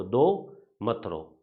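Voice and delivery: slow, spaced दो मत रो; mnemonic repeated. Shown as a demonstration. Teacher recapping the trick for students, slow and spaced. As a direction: say दो मत रो slowly as a rhyme to remember. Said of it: "अब दो को ऐसे अपन याद रखेंगे".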